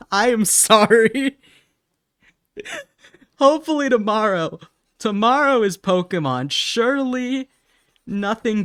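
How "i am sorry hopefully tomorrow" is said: higher and louder, still laughing; giggly apology. Real, not acted. Streamer promising chat tomorrow's stream, giggling. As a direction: reassure chat through laughter.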